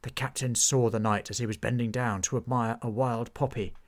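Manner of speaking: ordinary delivery, nothing marked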